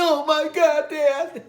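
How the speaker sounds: very high, loud, laughing scream; panicked shriek. Retold or imitated. Son shrieks at dad's threat, high loud panic. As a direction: shriek in mock terror.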